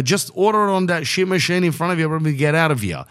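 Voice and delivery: fed up, just wants to leave; irritated snap. Retold or imitated. Snapping at Macca's worker.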